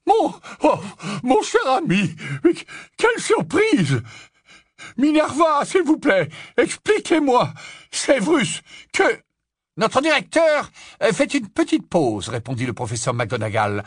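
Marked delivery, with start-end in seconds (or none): 0.0-9.0 high, loud breathless fluster; puffing and spluttering, confused; flustered old professor. Acted. 9.8-12.3 dry stern witch. Acted. McGonagall dry reply.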